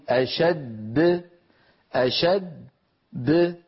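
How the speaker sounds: أشد said very slowly with the final د bounced; slow tajweed demo of أشد; stopping on أشد. Shown as a demonstration. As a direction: hold the doubled د in أشد, then release with qalqala. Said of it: "لو وقفنا عليها نقول".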